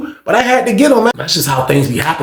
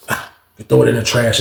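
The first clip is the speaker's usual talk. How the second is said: ordinary talk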